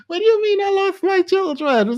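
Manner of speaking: high put-on voice of a stunned woman; mock outraged woman. Mocking the woman who lost custody, high and outraged. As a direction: squeal it like she can't believe it.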